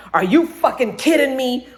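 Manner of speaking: high, incredulous mock anger; retold outrage. Retells son's outrage at dad.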